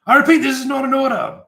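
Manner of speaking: high, loud commanding proclamation; dramatic shout. Acted. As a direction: proclaim it to stun the room. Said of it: "everyone's kinda shocked".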